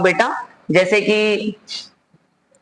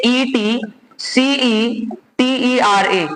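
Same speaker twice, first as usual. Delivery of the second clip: slow, letter by letter; spelling out; much slower than usual. Shown as a demonstration. Teacher giving students the spelling of et cetera, spelled out slowly. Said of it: "इसकी spelling होती है".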